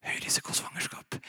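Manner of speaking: the diagnosis shrunk to a whisper; faint whisper of the old fear; whispered doctor's voice. Retold or imitated. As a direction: whisper it, now powerless. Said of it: "til slutt så var det sånn".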